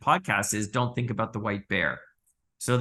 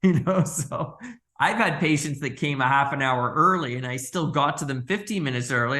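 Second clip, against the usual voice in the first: ordinary talk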